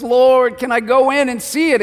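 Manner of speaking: high, pleading request; pleading Moses. Retold or imitated. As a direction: beg like a hopeful man. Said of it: "He goes".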